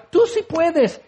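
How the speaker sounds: high-pitched quick pep; hammed-up high cheer; mock cheerleading. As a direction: chirp encouragement in parody. Mock reply to struggling friend.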